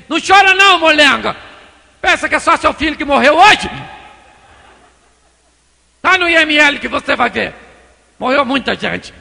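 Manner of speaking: loud, high-pitched telling-off; berating shout, no sympathy at all; harsh scolding. Acted. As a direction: scold a crybaby at full volume. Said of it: "Ele poderia falar também assim".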